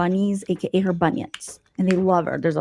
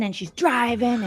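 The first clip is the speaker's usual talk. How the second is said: ordinary talk, nothing marked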